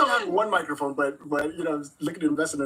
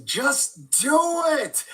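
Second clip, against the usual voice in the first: Shia LaBeouf shout; 11 semitones higher, much slower than usual. Retold or imitated. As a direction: yell it like a hammed-up motivational rant. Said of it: "I'd have to quote Shia LaBeouf and just say".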